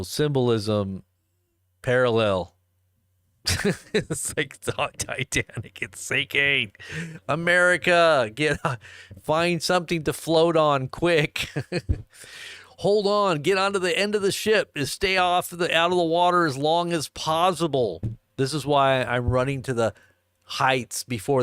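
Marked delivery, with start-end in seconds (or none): 3.5-6.7 laughing through the words. Real, not acted. Host amused by the Titanic comparison, laughing as he talks. 7.3-18.0 high-pitched comic alarm call; mock emergency warning. Host warning America aboard the sinking Titanic, mock-urgent call.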